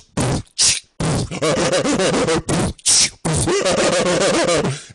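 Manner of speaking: imitated thumping music, spat-out beatbox noises; beatbox imitation. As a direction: mimic the loud car music with your mouth. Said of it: "The human beatbox was in the car?".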